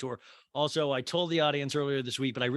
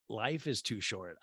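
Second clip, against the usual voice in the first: ordinary talk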